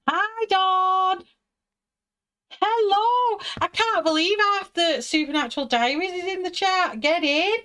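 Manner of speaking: high, loud, delighted hello; excited greeting. Real, not acted. Greeting friends arriving in chat.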